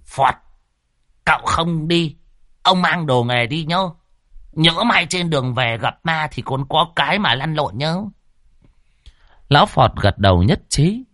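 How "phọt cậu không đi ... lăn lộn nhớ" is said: higher-pitched character voice giving a reminder; instructing a companion. Acted. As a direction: remind him lightly, in a raised pitch. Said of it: "ông Đồng có dặn". (giọng cao, dặn dò bạn đồng hành)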